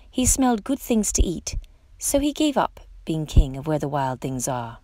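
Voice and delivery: plain reading voice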